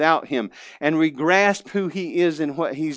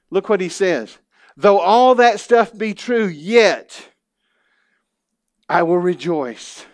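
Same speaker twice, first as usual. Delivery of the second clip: louder, higher fervent preaching, slowing to land lines; preaching at full force; 4 semitones higher, 5 dB louder than usual. Acted. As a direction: build the hardships up, then land the joy.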